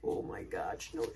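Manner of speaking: normal talking voice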